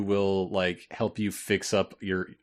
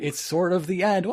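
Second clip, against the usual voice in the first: excited; 9 semitones higher than usual. Real, not acted. Excited announcement of the finale.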